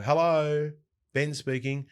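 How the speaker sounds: relaxed everyday phone greeting, lightly voiced; casual home phone answer. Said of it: "a different level of professionalism".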